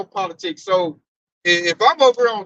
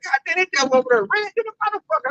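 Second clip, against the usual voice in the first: amazed; 10 semitones higher, faster than usual. Retold or imitated. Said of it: "I'm just like".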